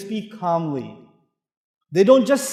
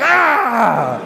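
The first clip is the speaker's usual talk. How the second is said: loud, drawn-out "Aah!"; mock pained cry; 3 semitones higher, 8 dB louder than usual. Preacher mimics men stung by women's answers, mock pained cry. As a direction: cry out as if stabbed, exaggerated.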